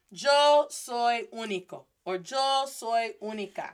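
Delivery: deliberate, word by word; slow model sentence. Shown as a demonstration. Teacher giving everyone a sentence to write down, slow and deliberate. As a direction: space out each word for learners.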